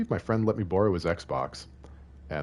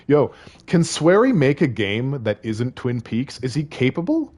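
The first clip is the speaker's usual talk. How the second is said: ordinary talk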